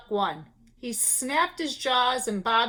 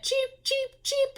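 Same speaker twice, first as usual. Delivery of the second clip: high, quick chirps; chicks cheeping; 13 semitones higher, slower than usual. A narrator's reading. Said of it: "exclaimed the little chicks".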